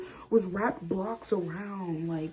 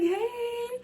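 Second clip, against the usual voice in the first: very high startled little hey; squeaky hey. Retold or imitated. Answering Destin, tiny very high hey. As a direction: squeak it out, starstruck. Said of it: "I was like".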